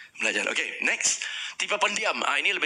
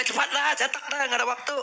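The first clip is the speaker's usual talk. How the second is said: impatient boss; 12 semitones higher than usual. Shown as a demonstration. Impatient leader type.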